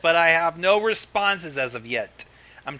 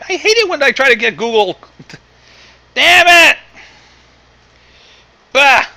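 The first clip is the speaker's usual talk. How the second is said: much louder and higher, fed up; frustrated outburst; 10 semitones higher, 13 dB louder than usual. Real, not acted. Host losing patience with his browser, loud frustration. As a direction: rage at the computer, half comic.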